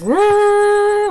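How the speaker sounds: high held sung note mimicking the music; sung dramatic piano sting. Reviewer mimicking the film's dramatic piano music with a high sung note. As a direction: sing the cheesy dramatic music cue. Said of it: "piano music they do where it goes".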